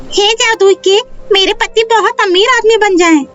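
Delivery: high-pitched put-on woman's voice; wishing wife. Pinky's mother wishes on the cake for her husband, high character voice.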